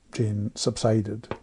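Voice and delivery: ordinary delivery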